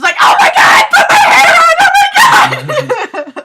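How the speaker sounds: very high, loud mock screaming; hammed-up panic. Reader mocks the author's panic, high loud shriek. Mocking hair on fire panic.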